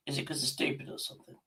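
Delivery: whispered, fast and teasing. Real, not acted. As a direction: whisper it quickly like a cheeky secret. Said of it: "Can't mimic that".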